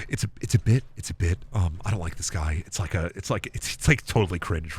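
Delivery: low, soft, faltering embarrassed mumble; acted sheepish excuse. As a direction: back down quietly, stumbling over the excuse. Acts out embarrassed fan excusing himself.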